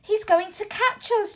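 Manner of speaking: high, breathless, frightened cry; panting in fear. A narrator's reading. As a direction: gasp it out while fleeing. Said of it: "George panted in fear".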